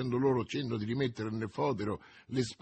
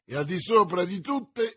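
high, loud, enthusiastic toast; carried-away exclamation; 7 semitones higher, 5 dB louder than usual. A narrator's reading. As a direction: raise a toast with fervour. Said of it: "esclamò D'Artagnan, quasi trasportato dall'entusiasmo". (brindisi esclamato con entusiasmo)

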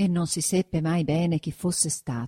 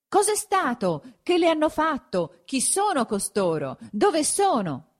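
mild shouts tumbling from many mouths, pitched high; crowd shouting questions; 10 semitones higher than usual. A narrator's reading. Fifty villagers to the curate at his window, mild high-pitched shouting. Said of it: "gli veniva gridato da cinquanta voci a un tratto".

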